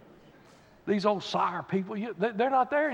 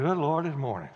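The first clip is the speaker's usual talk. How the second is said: grumpy groan; 5 semitones lower than usual. Retold or imitated. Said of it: "get up in the morning and say".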